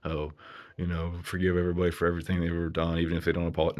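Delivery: mocking his old advice. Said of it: "going back on my one video".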